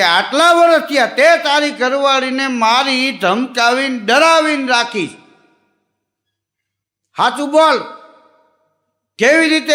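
loud, high police officer bark; officer grilling a wife-beater; stern interrogation. Acted. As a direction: demand the truth from the suspect. Police officer interrogates accused husband.

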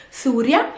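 Syria as SOO-ria with long "oo"; Arabic pronunciation. Shown as a demonstration. Teacher repeating the easy Arabic form, Syria as "soo-ria". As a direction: say Syria with "soo" first, lifting at the end. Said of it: "it's not Syria, it's Syria".

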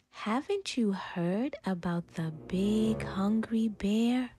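ordinary delivery

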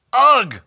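loud, strained grunt of effort; high, loud "ugh" tugging at something stuck; grumbled ugh. A narrator's reading. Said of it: "Bob grumbled".

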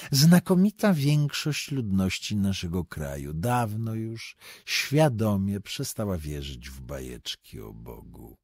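ordinary delivery, nothing marked

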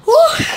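high excited whoop; sharp high "uh" whoop. Real, not acted. Whoop after running.

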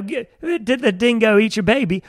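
high, loud attempt at Australian; Australian accent. Shown as a demonstration. Guest showing his lost Australian, loud hammed-up accent. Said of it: "whenever I try and do Australian now".

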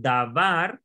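davar with var stressed; da-VAR, second syllable strongest; stress on final syllable. Shown as a demonstration. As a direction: put the emphasis at the back of davar. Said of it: "con énfasis acá atrás".

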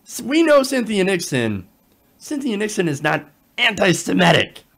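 mock-indignant supporters, voice jumping high; protesting Cuomo supporters. Cuomo supporters objecting that the smear went too far, mock protest. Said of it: "here you have Cuomo supporters saying".

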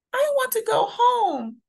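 put-on a small boy's tearful cry; crying child. A narrator's reading. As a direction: plead to go home like a scared child. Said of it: "Andre cries".

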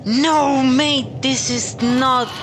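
high, loud cry of disbelief, voice shooting up; real dismay breaking out all at once; shocked outburst. Real, not acted. Player losing his humanity at the bonfire, shocked loud cry.

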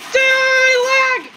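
high, drawn-out shouts; heated gamer outburst; raging yell. Real, not acted. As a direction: yell it at the game. Yelling at lag and enemies.

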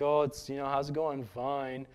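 a hint of a mopey donkey; gloomy Eeyore-ish reply. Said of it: "you sound like Eeyore".

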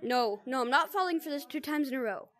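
ordinary delivery

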